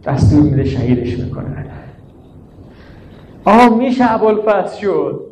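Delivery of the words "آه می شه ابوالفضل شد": wailing lament. Acted. Preacher to mourners, crying out in lament. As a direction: cry the line out in grief.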